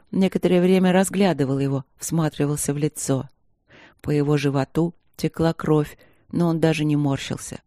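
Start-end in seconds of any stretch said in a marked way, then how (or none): none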